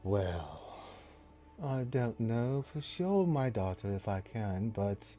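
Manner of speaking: slow, soft and hesitant, with a sigh; a priestess who can't refuse a champion; reluctant priestess. Acted. Priestess hesitates then agrees.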